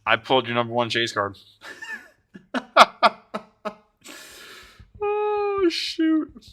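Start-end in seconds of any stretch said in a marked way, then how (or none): 2.5-3.1 high, short staccato giggle; gleeful laugh. Real, not acted. Streamer telling Tim about his chase card, gleeful giggle. 5.0-6.5 very high, thin squeal; giddy exclamation. Real, not acted. Streamer giggling over friend's chase card, high squeal.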